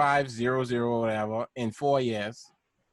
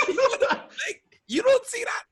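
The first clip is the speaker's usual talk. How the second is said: laughing in disbelief. Real, not acted. Fan marvels to friends at the catch, cracking up. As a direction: crack up while marvelling, voice shooting way up.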